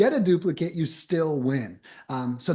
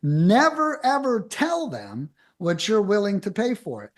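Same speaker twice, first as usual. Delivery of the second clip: firm coaching warning on Never; stress on never; 3 semitones higher than usual. Real, not acted. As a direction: hit Never hard, then carry on.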